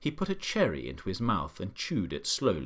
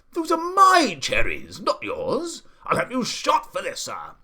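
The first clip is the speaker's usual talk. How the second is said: high, very loud, outraged bellowing; Duke's furious shouting; 10 semitones higher, 9 dB louder than usual. A narrator's reading. Duke to bird stealing his cherries, furious shouting. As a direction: rant at full volume, apoplectic with indignation. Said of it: "the Duke was shouting".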